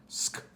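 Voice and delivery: "sk" as one blended sound, not letter names. Shown as a demonstration. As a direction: sound out "sk" as in the start of skate. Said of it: "a beginning sound that sounds like".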